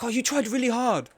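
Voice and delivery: high, cooing put-on reassurance; mock comforting. Imagined kind chat consoling the streamer, high mock-tender coddling. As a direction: soothe as if to a crying child. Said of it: "Never in these moments are you guys like".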